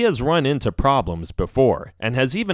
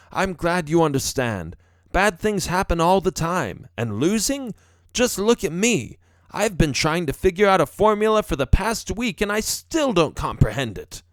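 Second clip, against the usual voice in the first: higher-pitched professor father voice; consoling father; 6 semitones higher than usual. A narrator's reading. Father comparing his failures to son's, consoling. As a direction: reason with the child, pitched up.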